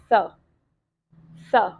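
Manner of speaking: "स" as an isolated short sound, twice; phonic sound demo. Shown as a demonstration. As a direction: model "स" alone with a pause after it. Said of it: "जिस तरह कि ये है". ("स" ध्वनि को अलग-अलग करके बोलना)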